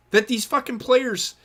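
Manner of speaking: ordinary talk, nothing marked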